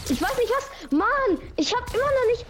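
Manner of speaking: high, exasperated complaining; frustrated gamer. Real, not acted. Frustrated player losing the fight.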